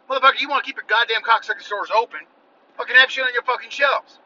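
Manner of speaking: ranting. Real, not acted. Ranting at stores.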